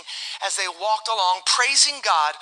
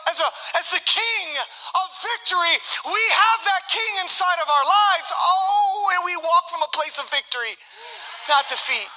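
raised, high-pitched sermon proclamation; impassioned preaching; 7 semitones higher than usual. Real, not acted. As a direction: proclaim victory to the congregation.

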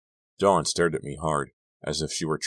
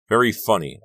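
dry and sarcastic, a bit louder; 5 semitones higher, 7 dB louder than usual. A narrator's reading. To Robbie after his toy gun scare, sarcastic. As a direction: brush off the prank with sarcasm.

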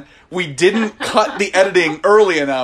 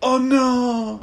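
mock heartbroken; 8 semitones higher than usual. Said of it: "He says it like".

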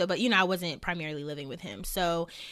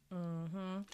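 while sipping a drink; 9 dB quieter than usual. Real, not acted. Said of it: "let me hydrate".

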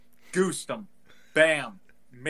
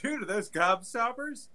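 eager; 8 semitones higher than usual. Retold or imitated. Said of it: "I'd be like".

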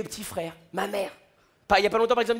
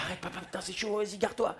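much softer, urgent pleading; panicked hush; 8 dB quieter than usual. Retold or imitated. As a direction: son begging his dad quietly to pull over. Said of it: "Je lui ai dit".

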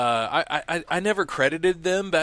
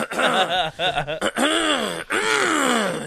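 imitated cough; 10 semitones higher, 3 dB louder than usual. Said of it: "He'll be like".

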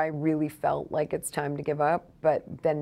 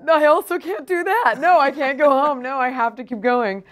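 high, loud, pleading refusal; loud desperate insistence; 9 semitones higher, 8 dB louder than usual. Retold or imitated. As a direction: insist you can't go home. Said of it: "And I was like".

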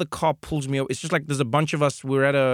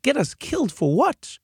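much higher, disbelieving squeak; incredulous; 11 semitones higher than usual. Retold or imitated. Driver to frightened passenger, incredulous high squeak. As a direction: scoff in disbelief.

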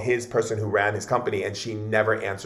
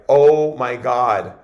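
freaking out; 7 dB louder, much slower than usual. Retold or imitated. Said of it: "they were all freaking out".

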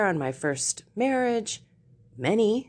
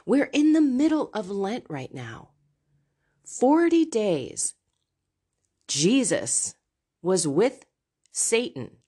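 ordinary talk, nothing marked

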